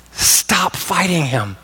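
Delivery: ordinary delivery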